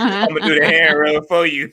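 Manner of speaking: high, loud smug Birdman voice; smug purring playboy. Retold or imitated. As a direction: purr it like Birdman, full swagger. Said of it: "Birdman voice".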